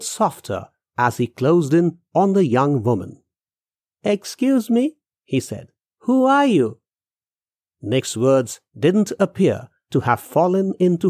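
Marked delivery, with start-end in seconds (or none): 4.0-4.8 higher-pitched man seeking attention; polite calling. Acted. Man approaches a strange young woman, polite calling. 6.1-6.8 high-pitched, insistent question. Acted. Man questions a silent stranger, insistent.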